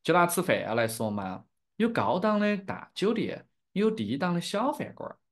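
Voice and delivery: Chengdu-accented Sichuanese Mandarin; demonstrating a Sichuan dialect accent. Shown as a demonstration. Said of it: "in my dialect".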